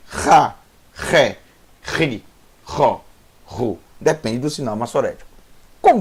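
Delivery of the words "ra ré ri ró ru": "Ra, Ré, Ri, Ró, Ru" rasped from the throat; guttural Het syllables. Shown as a demonstration. Teacher shows letter eight, guttural "Ra, Ré, Ri, Ró, Ru". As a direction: scrape each R in "Ra, Ré, Ri, Ró, Ru". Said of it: "Esse é o Het".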